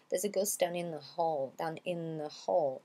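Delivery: "in the hall" run together as "in-na hall"; linked in the flow. Shown as a demonstration. Teacher shows spoken flow, "in the hall" linked as "in-na hall". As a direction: let "the" melt into "in". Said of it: "dans l'élan de la prononciation".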